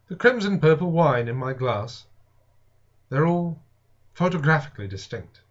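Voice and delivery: usual speaking voice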